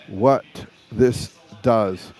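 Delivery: ordinary talk, nothing marked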